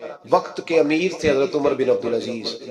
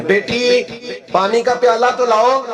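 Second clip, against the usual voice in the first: loud, higher call across the house; 6 semitones higher, 4 dB louder than usual. Retold or imitated. Father calls his daughter for water, loud raised call. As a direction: call out to a daughter in another room.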